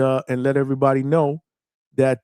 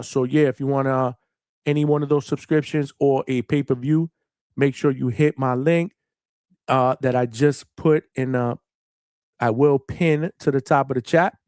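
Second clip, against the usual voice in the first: usual speaking voice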